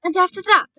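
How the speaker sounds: loud, high, eager question; eager excitement. A narrator's reading. Boy asking grandmother what follows, excited. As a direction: press keenly for what comes next. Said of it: "quivering with excitement".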